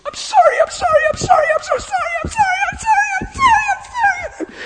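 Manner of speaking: high wailing sobs of sorry; imitating her sobbing sorry; sobbing apology. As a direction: cry it out over and over, very high and loud. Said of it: "she's repetitious".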